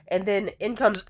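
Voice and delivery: ordinary delivery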